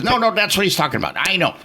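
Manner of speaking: a lightly voiced guest, fast and high, insisting; retold guest's protest. Guest on the panel objecting to the host, lightly voiced and quick. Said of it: "And he says".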